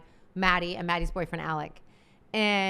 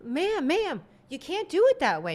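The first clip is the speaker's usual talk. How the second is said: high, pleading ma'am; husband politely stepping in, higher voice; imitated husband; 8 semitones higher than usual. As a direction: raise the pitch on ma'am, ma'am. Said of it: "he goes".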